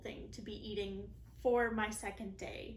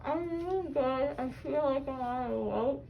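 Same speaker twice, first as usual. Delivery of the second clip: woozy post-surgery voice; loopy from laughing gas; 3 semitones higher, 7 dB louder than usual. Real, not acted.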